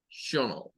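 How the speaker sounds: shonol said with a sh sound and a schwa; English "-tional" sound. Shown as a demonstration. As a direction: say shonol softly, sh then a schwa. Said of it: "en lo de shonol".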